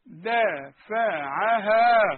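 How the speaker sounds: دافعها drawn out very slowly; slow demo. Shown as a demonstration. Teacher stretches the word to show the vowel. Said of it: "هتقول ايه؟".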